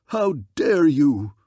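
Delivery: hammed-up enraged shriek of a leper king; furious scream. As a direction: drag out the outrage at full voice. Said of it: "he screamed".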